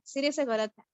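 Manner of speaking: ordinary delivery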